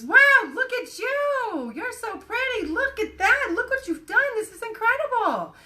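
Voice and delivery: gushing proud mom. Retold or imitated. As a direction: gush over the little girl like a delighted mom. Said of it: "mom observes her efforts and says".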